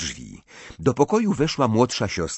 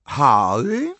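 higher, louder throaty greeting; 6 semitones higher, 6 dB louder than usual. A narrator's reading. Fleur greets Harry with breakfast, throaty. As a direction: call the name with a guttural foreign lilt. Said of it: "powiedziała gardłowym głosem".

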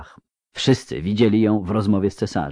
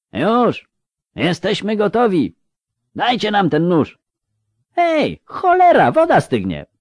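high and loud calling out; shouting together; 12 semitones higher, 3 dB louder than usual. Acted. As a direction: yell through the door for the knife. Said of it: "wrzasnęli chórem". (wołanie chórem, głośno i wysoko)

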